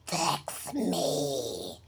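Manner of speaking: ordinary delivery, nothing marked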